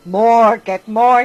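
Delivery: loud, insistent shout; crying out. A narrator's reading. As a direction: bellow it over the music. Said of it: "he cried".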